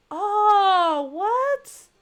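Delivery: loud, high cry of disbelief; shocked reaction. Real, not acted. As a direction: recoil in real shock, pitch shooting up. Shocked by chat's ghost story.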